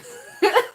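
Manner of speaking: high, loud burst of laughter; real laugh, sudden and high; cracking up. Real, not acted. Streamer cracking up over a love song, bursting out laughing. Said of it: "yo, I'm cracking up".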